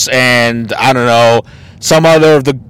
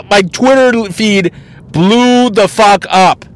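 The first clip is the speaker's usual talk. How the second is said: ordinary talk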